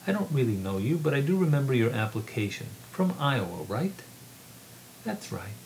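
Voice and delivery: measured reading voice, lightly voicing the characters; story narration. A narrator's reading. Author reading his novel aloud to a room, measured narration. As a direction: read the novel to an audience.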